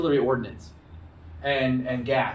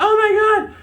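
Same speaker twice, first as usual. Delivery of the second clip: mock-panicked shriek, much higher and louder; 9 dB louder than usual. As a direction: shriek in comic terror at the U-boat. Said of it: "The transport ship's like".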